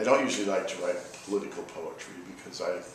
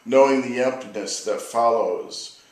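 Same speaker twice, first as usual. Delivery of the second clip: poetry reading; 3 semitones higher, 4 dB louder than usual. Acted. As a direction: let each line land like an elegy. Reads second poem aloud.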